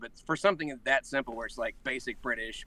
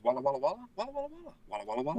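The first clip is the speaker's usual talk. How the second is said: high-pitched imitation of crowd walla; background walla; 13 semitones higher, much faster than usual. Actor friend filling anime background, mock walla. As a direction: repetitive mock background chatter. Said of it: "that literally goes".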